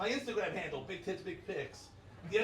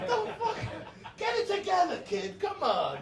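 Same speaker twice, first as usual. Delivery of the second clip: mock exasperated scolding; 9 semitones higher, 3 dB louder than usual. End of acted scolding.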